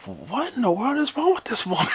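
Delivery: high-pitched mock disbelief; bewildered exclamation. Retold bewildered reaction to her outburst.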